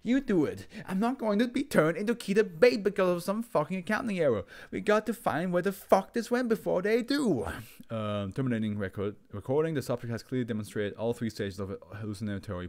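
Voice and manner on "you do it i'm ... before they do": high frantic shriek. Acted. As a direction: shriek in panic. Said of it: "His voice rising to a shriek".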